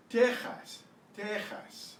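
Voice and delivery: "Texas" as TEH-khahs; Spanish pronunciation. Shown as a demonstration. Teacher modelling the Spanish name, "Texas" as TEH-khahs. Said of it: "this is Texas spelled in Spanish".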